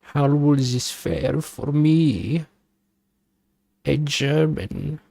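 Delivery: hamming up a German gasp of alarm; German accent. Pretend. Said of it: "is the German".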